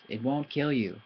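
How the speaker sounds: usual speaking voice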